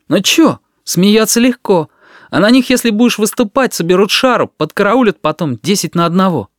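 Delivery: high, loud aggrieved retort; angry self-defence. A narrator's reading. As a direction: argue back crossly, voice climbing. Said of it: "Мосин кинул сердитый взгляд". (сердитое оправдание высоким голосом)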